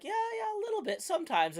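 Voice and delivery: very high-pitched, breezy mock answer; acted casual reply. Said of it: "and be like".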